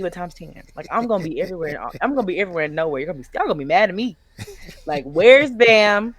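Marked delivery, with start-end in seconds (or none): 5.0-6.1 loud, higher mock call of fans. Mimicking fans asking where she went, loud. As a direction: call out like a confused fan searching.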